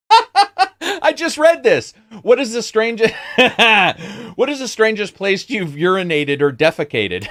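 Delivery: high, helpless laughing read; laughing while reading. Real, not acted. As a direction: struggle to get the question out through real laughter. Cracks up reading the question aloud.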